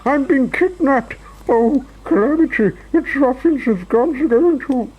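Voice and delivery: very high, squealing panic; Mister Jelly screaming. A narrator's reading. Mister Jelly thinks ruffians have got him, screaming. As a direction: wail in terror, then break off. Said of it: "he screamed".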